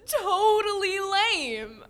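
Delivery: high, loud, drawn out with laughter; gleeful agreement. Acted. Girl agreeing with her new skeleton friend, gleeful drawl. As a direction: stretch it out, delighted to be understood.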